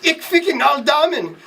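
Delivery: high-pitched hammed-up cry; shrill-pitched comic outcry in German; mock scream. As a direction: scream it in mock climax, without full volume. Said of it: "and screamed".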